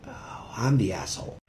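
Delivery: breathy whisper of a sudden admission; whispered realization. Retold or imitated. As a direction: whisper it like it just dawned on you. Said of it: "And I'm like".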